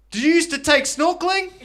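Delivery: high, loud, hammed-up query; big prisoner's question. Big prisoner recognising an old teacher, high loud questioning. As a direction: imitate a prisoner yelling a question. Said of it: "And he goes".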